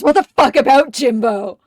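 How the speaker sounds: loud, exasperated snap; mock yell. Riddler shouting back at Ed, a loud mock yell. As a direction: bark it back in mock annoyance. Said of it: "Really goes".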